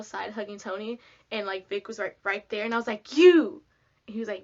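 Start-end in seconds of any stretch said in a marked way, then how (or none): 3.2-3.6 high, loud playful accusation; mock accusing shout. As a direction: call him out loud. Calling out Vic.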